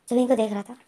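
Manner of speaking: ordinary delivery, nothing marked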